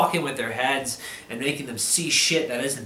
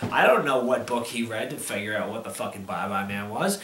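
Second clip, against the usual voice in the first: ordinary talk, nothing marked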